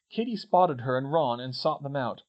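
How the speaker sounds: ordinary delivery, nothing marked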